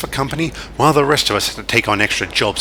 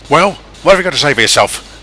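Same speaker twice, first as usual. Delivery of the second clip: a raised, louder boss scolding his engine; stern chief; 6 semitones higher, 7 dB louder than usual. Acted. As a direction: tell him off like an angry boss.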